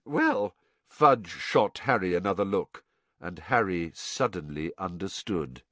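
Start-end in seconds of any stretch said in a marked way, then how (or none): none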